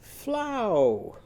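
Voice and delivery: fly becomes flow, sung up high. Shown as a demonstration. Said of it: "it's going to go".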